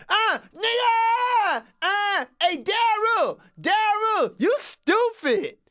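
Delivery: high, drawn-out slow screaming; screaming kid. Retold or imitated. Kid screaming at Daryl as he boards, high and slow. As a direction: scream at Daryl across the bus. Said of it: "he came on screaming".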